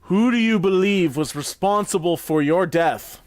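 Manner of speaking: raising the dead king, calling out loud; as loud as I can. Acted. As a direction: project the question over the crowd. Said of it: "I say as loud as I can".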